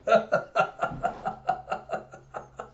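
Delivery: long, voiceless fit of giggles; wheezing laugh; real laughter. Real, not acted. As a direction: crack up helplessly. Host cracks up after confession.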